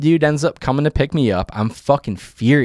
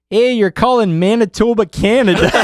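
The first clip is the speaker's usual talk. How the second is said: high-pitched mock Canadian phone lady; Canadian accent; 10 semitones higher, 3 dB louder than usual. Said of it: "like the most Canadian".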